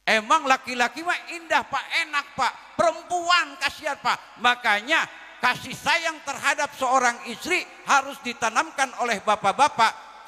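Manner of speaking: high, loud sermon exhortation; preaching at full force. Acted. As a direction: drive the point home to the men. (ceramah berapi-api untuk para bapak)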